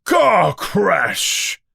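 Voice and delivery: Hagrid screaming. A narrator's reading. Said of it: "Hagrid screams at the Dursleys".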